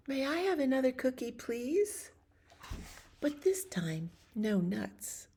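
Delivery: duckling politely asking the pigeon for another cookie. Acted. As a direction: ask sweetly like a small duckling.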